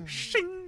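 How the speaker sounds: sword sound. Shown as a demonstration. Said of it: "that's the s- like in any movie".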